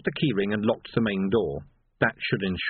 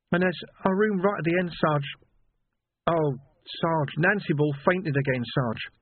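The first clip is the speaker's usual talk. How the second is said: put-on higher boyish voice, faltering; a shaken lad stumbling over words; trembling young constable; 8 semitones higher than usual. A narrator's reading. Young Sam reporting, distressed.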